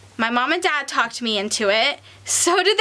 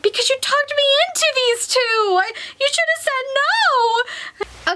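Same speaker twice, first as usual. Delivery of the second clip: mock-mad complaint; 10 semitones higher than usual. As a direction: playfully wail the blame, stretching the last words. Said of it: "I'm so mad at you two".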